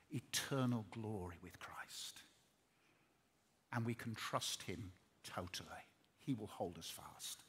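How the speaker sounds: preacher softly assures the congregation of eternal glory, whispered; hushed assurance. Acted. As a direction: breathe the promise out softly. Tender close of the sermon.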